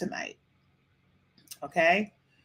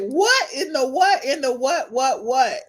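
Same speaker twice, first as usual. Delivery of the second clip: mock bewilderment; 5 semitones higher, slower than usual. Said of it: "I really would have been like".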